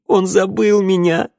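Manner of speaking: high, loud, anguished cry; choked-back sobs. A narrator's reading. Daughter forgotten by her father, crying out in anguish. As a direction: wail it out, fighting back sobs. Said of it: "Девушка подавила всхлип".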